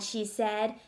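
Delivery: usual speaking voice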